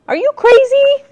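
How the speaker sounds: high, loud alarmed call. A narrator's reading. Brother calling after his sister in alarm, loud. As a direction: yell it after her in alarm. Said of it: "Jack called".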